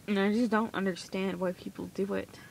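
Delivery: normal talking voice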